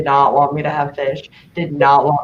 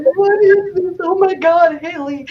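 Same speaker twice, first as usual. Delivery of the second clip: high, loud horrified squeal; shocked shriek; 12 semitones higher, 3 dB louder than usual. Retold or imitated. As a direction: squeal it out like you just saw something gross. Shocked at leech video.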